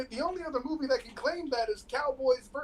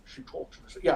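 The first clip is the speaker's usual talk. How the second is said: whisper; 10 dB quieter than usual. Acted. Repeating a quote secretly.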